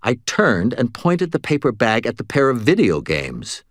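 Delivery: usual speaking voice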